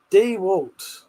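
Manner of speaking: "D Walt" said as dee walt; "D Walt", the way he says it. Shown as a demonstration. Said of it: "it's exactly how I say it".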